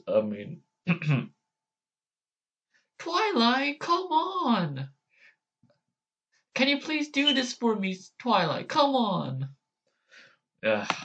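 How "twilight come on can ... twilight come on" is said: put-on much higher whiny kid dragon voice; Spike impression. Spike begging Twilight, high and whiny. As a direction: whine up high like a little dragon. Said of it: "How do I do Spike's voice?".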